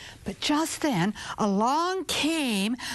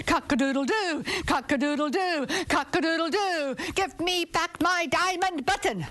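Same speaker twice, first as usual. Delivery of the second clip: each crow higher, building to the demand; rising rooster crow; 6 semitones higher than usual. Acted. Rooster on the windowsill demanding his button from the king, crowing ever higher.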